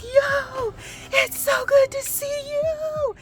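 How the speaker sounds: very high, stretched-out tearful squeal; overjoyed greeting. Retold or imitated. Greeting her surprise visitor in the retelling, squealing. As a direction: re-enact squealing with joy, drawing the words out. Re-enacting joyful greeting of best friend.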